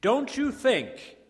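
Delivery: high, loud, emphatic sermon delivery; fervent preaching. Real, not acted. Preacher telling the congregation every type of person will come, fervent. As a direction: drive each point home to the congregation.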